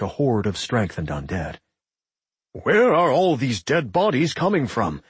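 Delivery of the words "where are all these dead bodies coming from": wary. A narrator's reading. Jonas smashing through endless skeletons, wary. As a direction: ask uneasily as enemies keep coming. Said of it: "Jonas asked warily".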